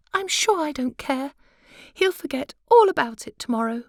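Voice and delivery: high, light wife's voice; high pitched fussy little wife; butterfly wife. Acted. Wife confides to queen.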